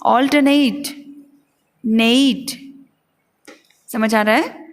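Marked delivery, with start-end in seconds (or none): 0.0-0.9 ऑल्टरनेट as "awl-tuh-NAYT"; teacher's prompt "awl-tuh-NAYT"; model for repeating. Shown as a demonstration. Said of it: "बोलिए". 1.8-2.6 नेट as a long NAYT; syllable demo. Shown as a demonstration. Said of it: "इसका उच्चारण होगा".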